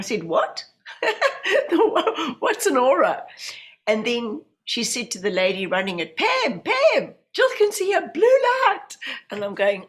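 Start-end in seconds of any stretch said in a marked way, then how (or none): 0.2-3.2 high, laughing disbelief; laughing surprise. Retold or imitated. Answering the medium, laughing and baffled. Retelling her own surprised reply. 6.2-9.0 much higher, louder, excited call. Retold or imitated. Woman calling to the course leader, high and excited.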